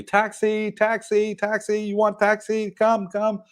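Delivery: high-pitched, insistent taxi hawker call; imitated tout's yell. Airport touts to arriving travellers off the plane, imitated yelling. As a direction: mimic touts calling out on one high note. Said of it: "they'd yell at you".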